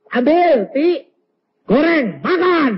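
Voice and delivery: high, loud kitchen orders; acted commanding wife. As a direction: call out orders to the maid.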